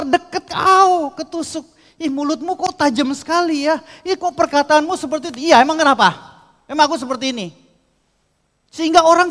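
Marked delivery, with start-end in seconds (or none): 1.9-5.5 annoyed complaint. Acted. People complaining about sharp tongue. 5.5-7.5 defiant retort. Acted. Defensive bitter reply.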